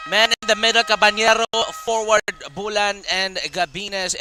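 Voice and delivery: loud, high hype call; excited commentator. Real, not acted. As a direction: pump up the lineup. Commentator hyping starters.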